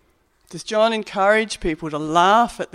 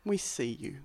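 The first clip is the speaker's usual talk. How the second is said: tender; 4 semitones lower, 10 dB quieter than usual. Real, not acted. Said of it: "and to say".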